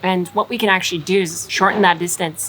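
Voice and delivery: usual speaking voice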